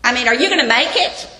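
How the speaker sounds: loud, exasperated scolding of a disappointed mentor; a mentor loudly scolding his slipping student; scolding rebuke. Pretend. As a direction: berate him, voice rising to the last line. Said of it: "He doesn't come to Timothy and say".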